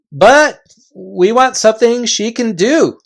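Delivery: loud, high chieftain calling a halt; gruff invisible chief. Acted. Invisible chief halting the Narnians, loud and high. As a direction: bark the warning from thin air.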